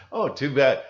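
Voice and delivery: loud, hammed-up excuse to leave; dismissive brush-off. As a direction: brush the friend off loudly and hurry away. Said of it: "And you go".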